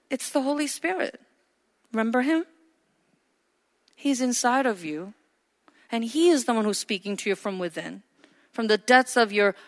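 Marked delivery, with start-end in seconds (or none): none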